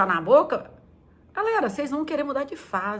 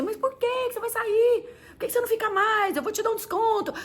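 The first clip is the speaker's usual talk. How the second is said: much higher pleading voice of the shocked gym owner; 12 semitones higher than usual. Retold or imitated. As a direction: beg the customer to stay. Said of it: "Fala assim".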